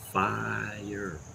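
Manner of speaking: ordinary talk, nothing marked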